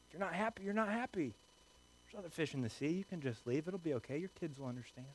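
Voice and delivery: very soft, confiding persuasion; hushed coaxing. Acted. Friends urging a spouse to leave the marriage, hushed coaxing. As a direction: coax them quietly, as if confiding.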